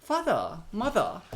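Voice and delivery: high, loud attempted British "father, mother"; British accent. Shown as a demonstration. Actor attempting Harry Potter for host, British accent. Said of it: "I can't do a British accent".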